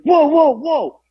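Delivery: high sharp call to stop the room; mock boss calling everybody to stop; calling out whoa.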